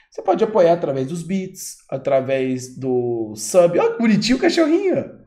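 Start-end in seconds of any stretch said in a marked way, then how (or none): 3.8-5.2 cooing. Real, not acted. Cooing at a passing dog.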